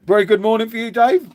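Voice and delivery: high, loud cheery hello to Dave; real bright greeting to Dave. Real, not acted. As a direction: sing out a big good morning.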